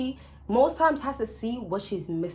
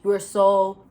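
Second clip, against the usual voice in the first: normal talking voice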